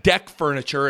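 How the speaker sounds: usual speaking voice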